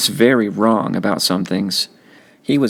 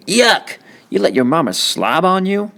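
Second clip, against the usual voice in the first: put-on teasing kids; 10 semitones higher than usual. Kids teasing in a retold scene.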